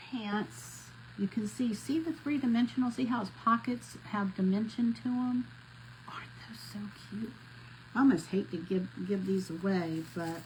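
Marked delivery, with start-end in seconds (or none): 6.1-7.3 whisper. Real, not acted. Gushing over ornaments while crafting.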